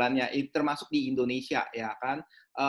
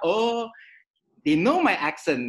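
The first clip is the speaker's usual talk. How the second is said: mock surprised "oh", high and loud, then slower; imagined pleased realisation; 6 semitones higher, 6 dB louder, much slower than usual. Boss hearing a familiar accent, pleasantly surprised. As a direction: act out someone realising they share a connection.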